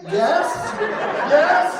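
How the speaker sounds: high, loud overeager yes; hammed-up eager yes to his wife; eager mock husband. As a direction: play the overly agreeable husband.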